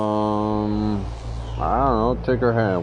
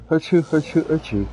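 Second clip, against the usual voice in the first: three sharp high sneezes; sneezing; 10 semitones higher, 4 dB louder than usual. Real, not acted. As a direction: sneeze out loud three times. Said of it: "I'm about to sneeze".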